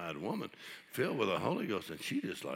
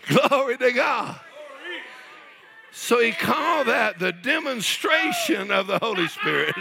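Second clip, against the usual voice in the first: loud, high, exultant church shouting; full-force preaching; 11 dB louder than usual. Real, not acted. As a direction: shout praise with whooping fervor.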